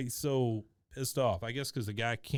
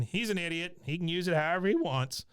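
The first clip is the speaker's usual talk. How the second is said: higher, snide jab; mock Cotton jab; 6 semitones higher, faster than usual. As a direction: toss it off like a grumpy old man.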